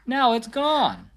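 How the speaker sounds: slow, sighing Minnie, mildly upset; a touch of worry, slowed down. Acted. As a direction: let it out on a sad little sigh. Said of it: "Minnie sighed".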